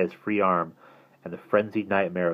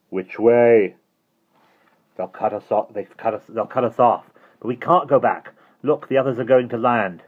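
panicked; 5 semitones higher, 7 dB louder than usual. A narrator's reading. Children trapped, panicking.

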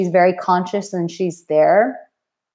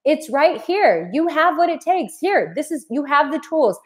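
encouraging guide; 8 semitones higher than usual. Acted. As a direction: call out like pointing to buried treasure. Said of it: "and going".

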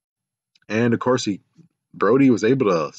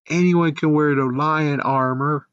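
exasperated; 6 semitones higher than usual. Real, not acted. Exasperated retort at the show.

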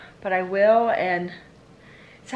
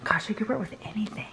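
whisper; 10 dB quieter, faster than usual. Real, not acted. Aside while daughter dresses.

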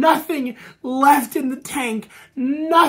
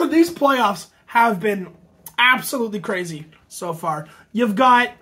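ordinary talk

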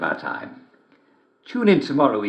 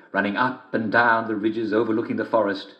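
usual speaking voice

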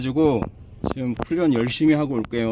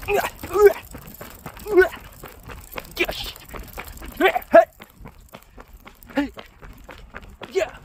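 running yells; faster than usual. Real, not acted. Running, shouting.

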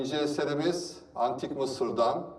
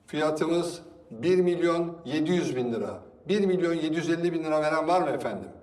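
auctioneer; 5 semitones higher, 3 dB louder, much faster than usual. Acted. Auctioneer calling bids.

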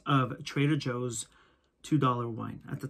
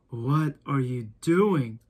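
diabolical voice; 5 dB louder, much slower than usual. Retold or imitated. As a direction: drag it out like a sinister boss. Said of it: "It was diabolical".

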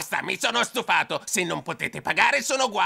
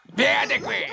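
angry shout; 6 semitones higher than usual. Acted. Toad thug shouting at the guests to leave. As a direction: chase them off the premises.

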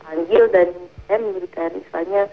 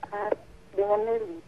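faint, distant phone voice; too quiet to hear clearly; barely audible; 11 dB quieter than usual. Real, not acted. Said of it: "suaranya agak terlalu kecil di sini".

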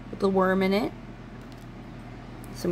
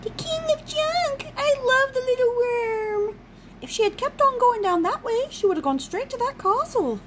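worm impression. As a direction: play a tiny squeaking worm, dragging the words. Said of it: "horrible impersonation of him".

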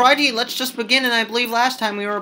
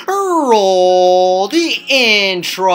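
loud, long drawn-out shout; booming, held-out intro cue; showy intro call; 9 dB louder, much slower than usual. Real, not acted. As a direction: stretch each word out like a ringmaster. Announces the channel intro.

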